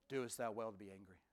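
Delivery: soft, hushed, tender question; gentle God. Acted. God gently questioning angry Jonah, soft and tender. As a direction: ask it gently, full of grace.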